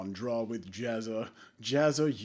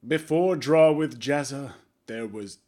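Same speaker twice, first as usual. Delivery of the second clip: slow, louder trailer-style narration; dramatic intro; 7 dB louder than usual. Shown as a demonstration. Vlogger tries a dramatic third-person intro, slow and loud. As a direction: announce it like a movie trailer. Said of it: "my attempt at doing a dramatic intro".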